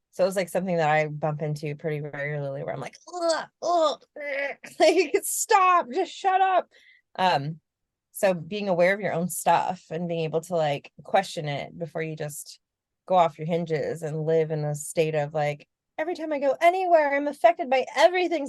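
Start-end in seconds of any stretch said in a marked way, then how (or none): 3.1-6.7 high-pitched, fed-up groans into a plea to stop; exasperated recoil. Retold or imitated. Acting out being triggered by others. 16.0-18.5 high, rushed hypersensitive whine; mock overwhelmed. Mocking an unsustainable oversensitive state.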